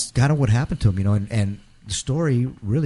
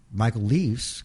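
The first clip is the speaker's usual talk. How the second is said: ordinary delivery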